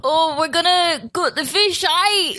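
high, hammed-up Geordie impression; exaggerated, badly faked Geordie; Geordie accent. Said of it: "can you do a Geordie accent?".

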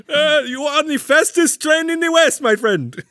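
high, loud hammed-up Middle Eastern accent; a Middle Eastern accent playing a cowboy train host; mock Middle Eastern accent. Disney cowboy ride host greeting riders, mock Middle Eastern accent.

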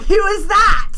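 high, loud, baffled outburst; exaggerated disbelief; mock incredulous question. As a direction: shout it in put-on bewilderment. Acted crowd reaction in retelling.

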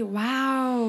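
normal talking voice

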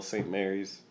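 ordinary delivery

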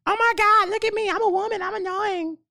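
very high mock girly voice; female voice. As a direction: squeal it like a ditzy girl. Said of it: "the female version of it".

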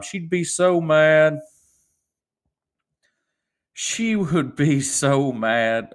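slow, emphatic "so mad"; drawn out. Real, not acted. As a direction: stretch it out slowly. Stretching out how mad she gets.

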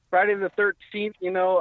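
ordinary talk, nothing marked

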